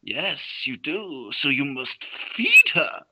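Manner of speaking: slow, deliberate mock German villain; hammed-up cruel doctor reply; cold villain. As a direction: spell it out slowly like a sadistic captor. Villain answering the captive.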